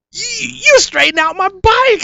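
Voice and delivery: put-on very high, awed child's voice; stunned little boy's wonder; amazed kid. Boy seeing his bike fixed by Lee Majors, high and amazed. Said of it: "I'm like".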